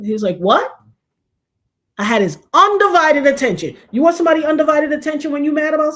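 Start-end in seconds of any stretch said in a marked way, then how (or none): 0.4-0.7 louder, rising "what?"; mock-baffled, punchy query; puzzled husband. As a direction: imitate someone straining to hear. Husband straining to hear her.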